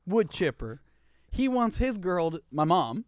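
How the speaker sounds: ordinary talk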